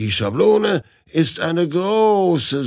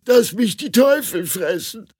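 much higher, louder wailing despair; despairing lament; 12 semitones higher, 4 dB louder than usual. Acted. The groom laments his wife waiting on the other train, wailing. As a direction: wail over the waiting wife.

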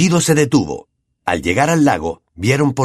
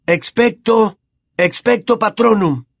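high strained spell; 11 semitones higher, slower than usual. A narrator's reading. Harry alone, casting.

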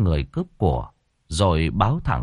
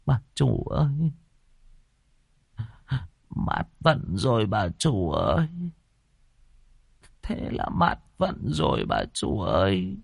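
softer, tearful lament at the altar; weeping lament; 3 semitones higher, 6 dB quieter than usual. A narrator's reading. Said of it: "mà khóc lóc". (khóc than khẽ, kéo dài)

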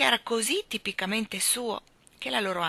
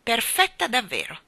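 outraged; 4 semitones higher, 5 dB louder than usual. A narrator's reading. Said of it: "arrabbiata, oltraggiata".

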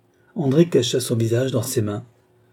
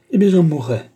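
high, loud retort; defiant outburst; 6 semitones higher, 6 dB louder than usual. Acted. Sister defies her brother's warning, loud retort. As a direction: throw it back defiantly, louder.

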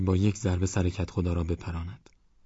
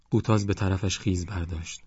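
ordinary delivery, nothing marked

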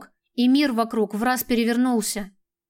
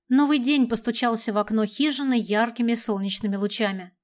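plain reading voice

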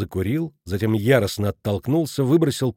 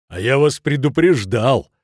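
loud, accusing outburst; furious cry; 5 dB louder than usual. A narrator's reading. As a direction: shout it as an angry reproach. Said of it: "бешено сверкая глазами, закричал Шмидт". (гневный выкрик)

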